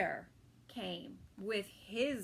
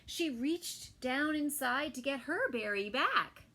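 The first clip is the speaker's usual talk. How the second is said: ordinary delivery, nothing marked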